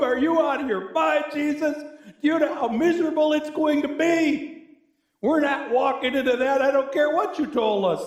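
high exasperated refusal; indignant Martha. Retold or imitated. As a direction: play Martha aghast at opening the tomb. Martha protests to Jesus.

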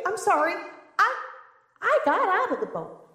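ordinary delivery

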